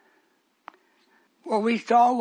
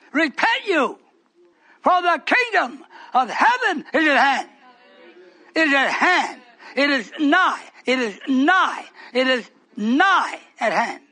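preaching; 3 semitones higher than usual. Acted. Preacher proclaiming repentance to his hearers. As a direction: proclaim it, then slow down on nigh.

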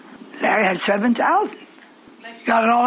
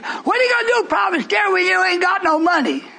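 mocking doubters; 10 semitones higher than usual. Preacher mimicking the congregation's doubt about money, high mock shout. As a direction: mimic the scoffers in a raised, shouting pitch. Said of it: "I told you for years".

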